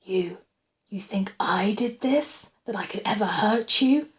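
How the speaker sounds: ordinary delivery, nothing marked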